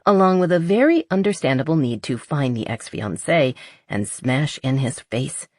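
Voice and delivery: usual speaking voice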